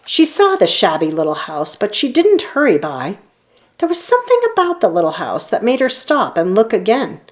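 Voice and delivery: plain reading voice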